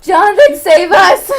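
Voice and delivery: loud, high comic cry for help; desperate shout. Real, not acted. Host begging Jonathan to rescue the podcast, desperate shout. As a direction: holler for rescue, half laughing.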